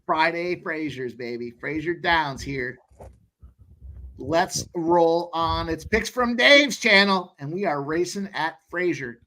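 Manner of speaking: excited race-calling patter. Acted. Host calling the race for stream viewers, excited. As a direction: call the horses like a track announcer. Calls horse race live.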